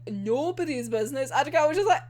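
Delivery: normal talking voice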